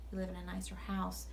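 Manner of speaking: ordinary delivery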